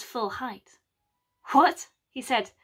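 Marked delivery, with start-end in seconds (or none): 1.5-1.9 louder and higher, affronted; indignant what. Acted. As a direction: bristle at the insult. Mac offended, drawing himself up.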